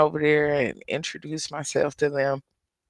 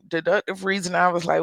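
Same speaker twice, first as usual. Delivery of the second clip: ordinary talk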